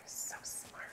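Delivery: soft voiceless whisper. Real, not acted. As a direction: breathe it as an aside. Quiet aside to husband.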